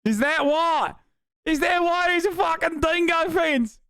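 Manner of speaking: high, mock-outraged Australian accent; hammed-up Aussie yelping in disbelief. Streamer heckling a video about the dingo fence, mock Australian accent. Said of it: "my Australian accent".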